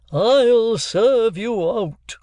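very slow, loud hammy bellow; barbarous bellow. Acted. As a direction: roar it like a stage murderer. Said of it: "he barbarously bellowed".

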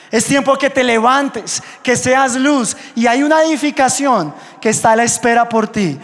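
loud, urgent call to rise up; rally the youth like a fiery preacher; preaching at full force. Acted. Pastor urges the youth to rise up, preaching loudly.

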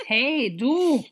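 loud, high call across the bridge. Acted. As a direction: shout to get her attention from afar. Calling out to woman on bridge.

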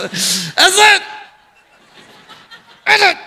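exasperated parent calling out, high and loud; mock-angry yell, held back then bursting out; furious dad hollering in public, hammed up. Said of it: "Now I'm angry at this point".

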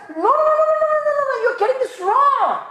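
high, loud repeated no; emphatic correction. Acted. Preacher voicing Isaiah correcting the crowd, loud insistent. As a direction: cut them off, loud and high. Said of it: "Isaiah is telling us".

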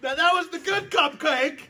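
high, loud, pained protest; high pained complaint. Real, not acted. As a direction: complain through the burn, rising to a shout. Burning from hot sauce.

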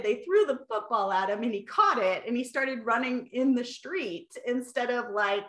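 ordinary talk

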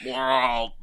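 failed gruff impression, drawn out; Vin Diesel. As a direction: attempt a monster-like action-star growl. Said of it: "it's just like".

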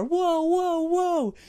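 very high, loud whoa; father and son freaking out in the car. Retold or imitated. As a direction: squeal with kid wonder.

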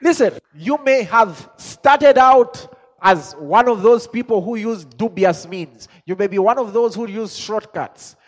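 loud, high, drawn-out sermon delivery; full-force preaching. Real, not acted. Preacher urges listeners to change their story, full-force preaching.

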